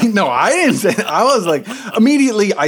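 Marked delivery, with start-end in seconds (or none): 0.0-1.1 defensive. Retold or imitated.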